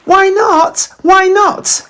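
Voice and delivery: high, insistent little voice; Gruffalo's child. Acted. Gruffalo's child begging her dad, high and insistent. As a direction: pester Dad like an eager child.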